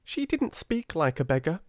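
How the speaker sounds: put-on high, eager girl's protest; girl crying out. A narrator's reading. Younger sister joins in defending the girl, high and eager. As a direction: insist it with a lift. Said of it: "cried Nora".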